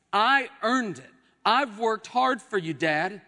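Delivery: indignant grievance. Acted. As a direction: insist you have earned it. Older brother's grievance to father, paraphrased.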